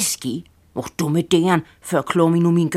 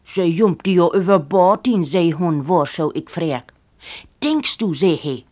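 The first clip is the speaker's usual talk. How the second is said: usual speaking voice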